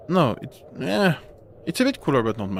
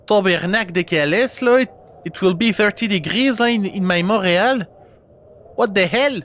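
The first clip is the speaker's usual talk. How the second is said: hammed-up Quebec accent, higher-pitched; 6 semitones higher, 3 dB louder than usual. Streamer mocking a Montrealer complaining of heat, in exaggerated Quebec accent. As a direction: put on a broad, indignant Quebec accent. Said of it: "do my Quebec accent".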